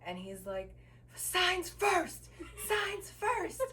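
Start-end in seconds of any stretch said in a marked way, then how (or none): 1.2-3.6 high-pitched, insistent mock yell; imitating a yelling man. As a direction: mimic his yelled demand, pitched way up. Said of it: "don't yell at me".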